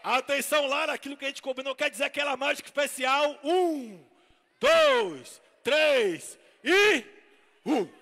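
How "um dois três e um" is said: loud countdown with a jump up on dois; showman's shouted count. Acted. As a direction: build tension counting aloud. Dramatic countdown.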